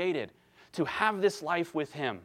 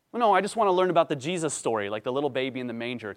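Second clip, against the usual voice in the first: impatient asker; 4 dB louder, much faster than usual. Retold or imitated. Mock listener pushing back.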